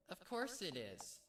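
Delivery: high, bright-eyed cheerful filly; bouncy cheerful sister voice; happy Pinkie. Acted. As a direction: chirp it out happily, voice up high. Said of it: "Pinkie said happily".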